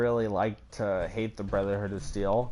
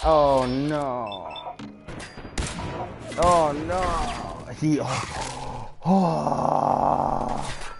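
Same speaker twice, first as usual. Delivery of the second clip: high, loud panicked oh no; startled gamer panicking mid-fight; real panic; 5 semitones higher, 3 dB louder, much slower than usual. Real, not acted. Enemy attack during gameplay.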